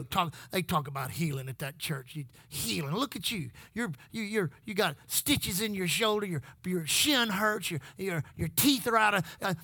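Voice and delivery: whisper-low taunts of the devil; devil's voice. Pretend. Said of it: "that's exactly how the devil talks to you".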